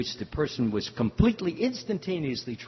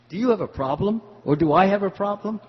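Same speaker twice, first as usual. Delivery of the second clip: raised, higher, louder: pointed retort; indignant challenge; 8 semitones higher, 8 dB louder than usual. Retold or imitated. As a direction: challenge the man who keeps shoving you. Confronting man who shoved him.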